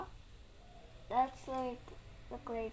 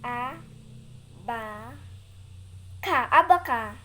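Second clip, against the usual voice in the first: loud syllables then blended word; sounding out syllables; 12 dB louder than usual. Real, not acted. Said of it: "I'm gonna read".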